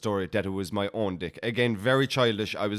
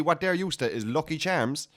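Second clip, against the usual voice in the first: ordinary delivery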